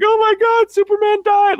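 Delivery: very high, loud mock-kid wail; dumb kid. Host mimics dumb kids fooled by the ending, shrieking. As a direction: squeal it like a gullible, upset child. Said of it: "dumb kids who might be like".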